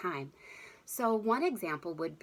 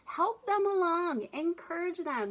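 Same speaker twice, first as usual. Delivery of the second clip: encouraging; 10 semitones higher than usual. Real, not acted. Coaching parents to encourage child.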